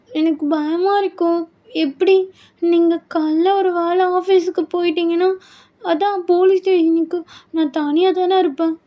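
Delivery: anxious bride. Acted. Bride afraid of being left alone, anxious.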